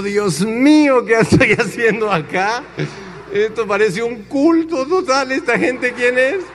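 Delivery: high, drawn-out mock panic; alarmed bewilderment. Newcomer thinking it is a cult, high alarmed disbelief.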